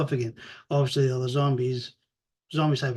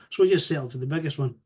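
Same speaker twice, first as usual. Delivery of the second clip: ordinary delivery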